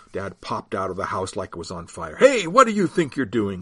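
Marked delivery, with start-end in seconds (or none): 2.2-3.6 loud, higher angry shout; dad yelling at the disciples. Acted. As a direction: yell at the strangers. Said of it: "he yelled".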